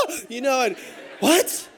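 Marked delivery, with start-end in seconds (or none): 0.1-0.1 sudden high mock scream of fright. Preacher acting out a barracuda scare, mock scream. 1.2-1.7 loud, high mock-startled question; shocked disbelief. Snorkeler told of a barracuda below, shocked.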